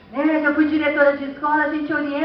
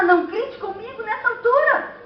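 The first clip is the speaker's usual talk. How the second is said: mild mock scolding; 7 semitones higher than usual. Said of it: "Mas como você fala".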